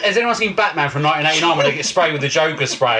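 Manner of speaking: normal talking voice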